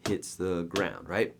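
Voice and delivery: ordinary talk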